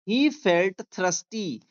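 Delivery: very slow, word by word dictation; slow classroom reading aloud. Shown as a demonstration. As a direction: read it out slowly for students to follow. Teacher dictating story sentence slowly.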